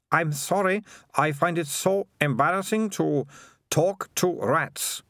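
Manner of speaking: reluctant high-voiced apology; Melissa, awkward girl. A narrator's reading. Melissa to Keith about talking to rats, awkward apology.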